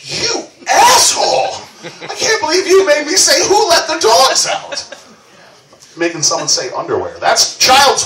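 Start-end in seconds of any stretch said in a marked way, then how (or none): 0.0-4.9 high, slow, laughing fake fury; mock outrage. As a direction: play the girlfriend's outraged disbelief. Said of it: "she's like".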